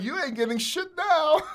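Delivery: high, loud laughing jeer; laughing taunt. Retold or imitated. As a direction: gloat while cracking up.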